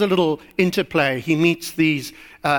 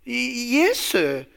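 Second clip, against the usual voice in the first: nervous stammer on a higher first word; stammering boy; 6 semitones higher than usual. Acted. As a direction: stumble into the answer, then drop. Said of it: "stammered the boy".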